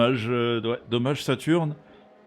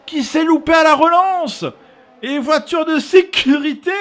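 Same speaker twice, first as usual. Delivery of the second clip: loud, high excited race call; motorsport commentator; 12 dB louder than usual. Real, not acted. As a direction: shout the incident as it happens. Live crash call on stream. (commentateur sport auto survolté)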